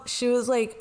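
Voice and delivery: usual speaking voice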